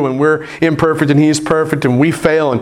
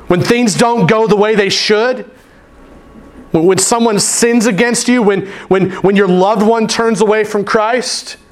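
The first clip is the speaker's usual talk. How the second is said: raised, urgent sermon voice; preaching; 7 semitones higher than usual. Acted. Pastor preaching to his church, urgent and raised. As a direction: press the congregation with rising urgency.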